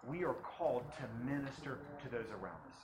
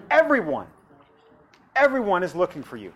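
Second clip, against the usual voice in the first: excited exclaim; 6 semitones higher, 18 dB louder than usual. Acted. Disciples exclaim, loud.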